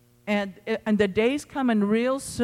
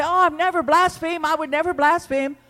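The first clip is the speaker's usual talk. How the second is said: high mock protest, louder, playing the offended believer; indignant protest; 8 semitones higher, 4 dB louder than usual. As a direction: scoff it out like someone who swears they'd never. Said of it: "you say".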